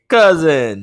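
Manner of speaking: bad Russian accent, "cousin" punched out; shitty Russian accent. Podcaster mocking his own bad Russian accent on "cousin". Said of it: "a shitty Russian accent".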